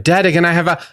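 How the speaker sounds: put-on high-pitched little kid request; high voiced kid asking openly; son asking out loud. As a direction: imitate a young son piping up, cut off mid-sentence. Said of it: "he'll say it out loud".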